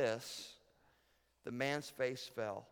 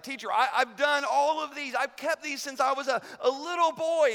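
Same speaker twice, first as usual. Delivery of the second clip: much higher and louder, eager boast; excited young man; 11 semitones higher, 10 dB louder than usual. Retold or imitated. Rich young ruler eagerly answers Jesus, excited and loud. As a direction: blurt it out like an eager kid. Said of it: "The guy's really excited".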